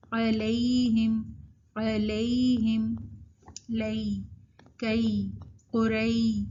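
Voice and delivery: علیہم with a long "lay" glide; correct leen. Shown as a demonstration. Teacher drilling ya leen, long glide. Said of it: "جو صحیح طریقہ ہے".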